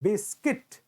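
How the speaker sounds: बिस्किट said with a short i; correct pronunciation. Shown as a demonstration. Teacher answering his own question, बिस्किट with i. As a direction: answer with बिस्किट, bis-kit, not biskut. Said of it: "What will you pronounce?".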